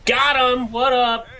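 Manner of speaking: loud, high victory yell; excited shout. Real, not acted. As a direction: shout it out after a kill. Kill in online shooter.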